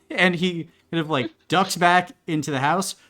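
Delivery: ordinary talk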